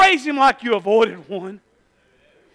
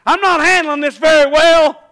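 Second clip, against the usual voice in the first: mock confession; 3 semitones higher, 7 dB louder than usual. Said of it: "apologize to somebody".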